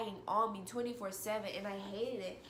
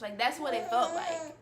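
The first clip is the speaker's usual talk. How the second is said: ordinary delivery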